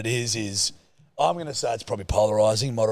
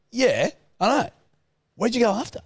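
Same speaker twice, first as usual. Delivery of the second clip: high, keen, chummy bloke voice; eager mate; 12 semitones higher, 3 dB louder than usual. Retold or imitated. Gym stranger quizzing a mate about last night, eager. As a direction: imitate an over-familiar pal, pitched right up. Stranger greeting him as old pal.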